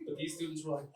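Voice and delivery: normal talking voice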